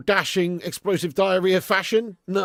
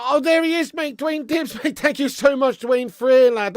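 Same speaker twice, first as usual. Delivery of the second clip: excited; 8 semitones higher, 6 dB louder than usual. Real, not acted. Donor spotted in chat.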